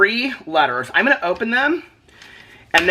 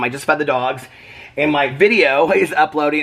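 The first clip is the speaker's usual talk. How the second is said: ordinary talk, nothing marked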